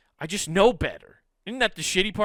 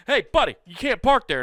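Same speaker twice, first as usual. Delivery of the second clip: higher, louder "hey buddy" scold; scolding call-out; 9 semitones higher, 7 dB louder than usual. Retold or imitated. Scolding a stranger about his parking, loud and high. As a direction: call him out across the lot. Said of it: "and I was like".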